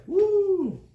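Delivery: high gleeful whoop of excitement; excited woo. Shown as a demonstration. Parent showing child how excitement sounds, excited woo. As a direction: let out a happy woo. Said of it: "the excitement is like".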